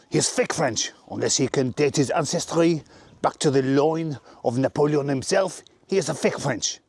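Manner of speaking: hammed-up French accent; disdainful comic Frenchman with a French accent. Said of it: "French accent".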